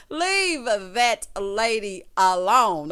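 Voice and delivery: loud and very slow, every word stretched; drawn-out mock scolding. Host repeating the order to imagined Monique, slow loud scolding. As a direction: playfully drag out each word at full voice.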